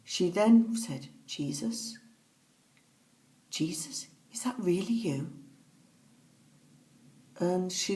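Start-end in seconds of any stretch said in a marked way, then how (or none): 1.2-5.3 hushed, questioning whisper; very soft retold question, voice nearly gone; awed whisper. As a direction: whisper it in disbelief at who has appeared. Retelling mother's awed question to Jesus.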